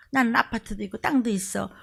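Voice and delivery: usual speaking voice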